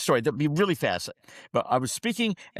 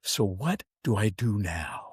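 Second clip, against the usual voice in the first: low, slow, helpless question; retold emotional plea; 8 semitones lower, slower than usual. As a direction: ask it slowly, as if lost and moved. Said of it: "he grabbed me emotionally".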